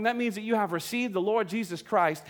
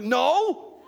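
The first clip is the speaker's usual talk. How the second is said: mock denial; 7 semitones higher, 5 dB louder than usual. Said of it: "I'm like".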